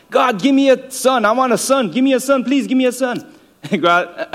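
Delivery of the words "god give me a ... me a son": high pleading prayer, a mother begging God. Retold or imitated. Mother praying to God for a son, high and pleading. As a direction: beg high and urgent for a son. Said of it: "Samuel's mommy, it's like".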